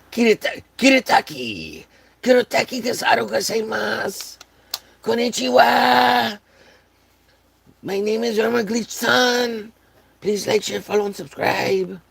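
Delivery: squeaky mock Japanese accent. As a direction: ham up a fake Japanese accent.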